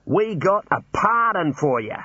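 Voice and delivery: high, drawn-out call; mild shout, slow and high. A narrator's reading. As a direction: stretch the news out as a distant call. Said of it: "he shouted".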